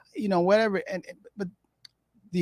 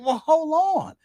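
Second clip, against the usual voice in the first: mock offended mourner; 8 semitones higher, 3 dB louder than usual. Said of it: "They be like".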